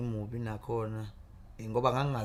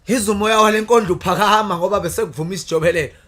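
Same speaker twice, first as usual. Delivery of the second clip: loud declaimed praise poetry; poem recitation; 8 dB louder than usual. Acted.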